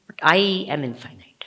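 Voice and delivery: secret whisper of the realization. Shown as a demonstration. Teacher whispers the truth to her students, whispered. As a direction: breathe it like a secret.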